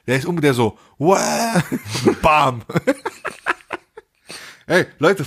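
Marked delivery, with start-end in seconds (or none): 1.0-1.6 mock collapse cry. Boyfriend collapsing, retold. 2.7-3.8 a high, breathy burst of laughter; high squealing laugh. Real, not acted. Teller cracks up at his own story, high laugh.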